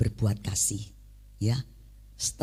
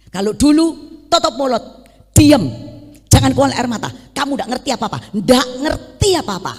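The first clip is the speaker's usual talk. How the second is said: high, loud barking commands; angry shouting husband; 9 semitones higher, 9 dB louder than usual. Retold or imitated. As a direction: bark orders to silence her. Husband silencing wife, retold. (suami membentak dengan marah dan keras)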